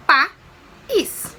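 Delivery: "País." said as pa-ís with a rising end; teacher sounding out "País." in syllables; syllables split apart. Shown as a demonstration. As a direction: break "País." into its two syllables. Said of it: "Se eu separasse a palavra país nas sílabas".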